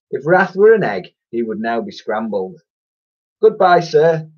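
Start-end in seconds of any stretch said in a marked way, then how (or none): 3.4-4.3 high, loud mock-polite farewell; cheeky fake-sweet goodbye; sarcastic singsong send-off; sarcastic chirp. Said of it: "chirped Ned sarcastically".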